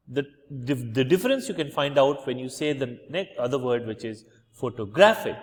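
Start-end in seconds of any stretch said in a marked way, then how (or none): 4.5-5.4 photo-GRAPH-ic, graph lifted; shifted stress in photographic. Shown as a demonstration. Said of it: "shifts from first syllable to the second syllable".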